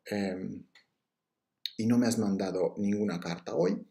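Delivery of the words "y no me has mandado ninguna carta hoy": usual speaking voice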